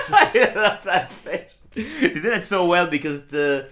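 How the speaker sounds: words bubbling with laughter; giggly delight; laughing. Real, not acted. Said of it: "laughing while talking".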